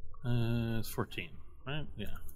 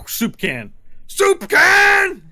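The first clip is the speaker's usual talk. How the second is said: a building battle cry, much higher and louder; yelling; 10 dB louder, much slower than usual. Acted. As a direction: scream the war cry at full force. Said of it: "He yells".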